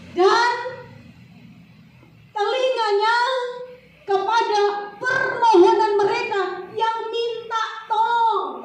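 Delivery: high, loud, half-sung crying out; preaching at full force. Acted. Preacher acting a desperate cry for help. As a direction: cry out to God with all you have. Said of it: "Ini kita teriak nih ya".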